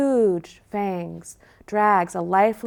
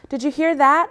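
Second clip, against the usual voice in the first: alarmed; 6 semitones higher, 5 dB louder than usual. Acted. Alarmed puppy asks.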